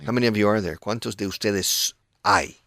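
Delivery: normal talking voice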